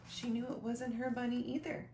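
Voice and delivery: ordinary delivery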